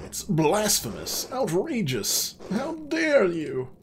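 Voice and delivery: rising to a high, loud, slow outburst; How dare you? hurled high and slow; outraged abbot. Acted. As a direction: build from indignant to a furious cry. Abbot voiced, outraged at guest.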